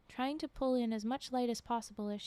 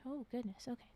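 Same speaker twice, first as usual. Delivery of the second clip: ordinary delivery, nothing marked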